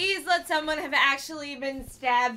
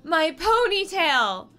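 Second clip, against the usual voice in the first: slow, raised wail; hammed-up distress; melodramatic lament; 6 semitones higher, 5 dB louder, slower than usual. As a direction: mourn it with mock horror. Victim laments her cut hair.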